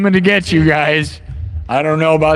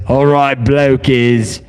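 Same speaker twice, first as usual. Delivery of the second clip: mock British accent; 5 semitones lower than usual. Vlogger mocks a British-looking AI Bryan, in a mock British accent. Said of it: "Is that what he says?".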